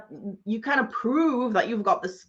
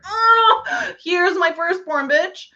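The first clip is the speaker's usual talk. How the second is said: high, loud, wailing mock offer of a firstborn; mock desperate offer; 7 semitones higher, 5 dB louder, slower than usual. Mocking sellers who give away everything, in a loud mock wail. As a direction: fling out the offer in a hammed-up wail. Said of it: "everybody would be like".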